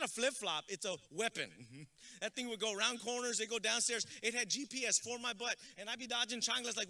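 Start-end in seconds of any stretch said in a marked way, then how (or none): none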